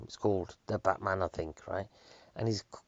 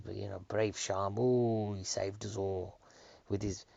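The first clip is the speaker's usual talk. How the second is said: normal talking voice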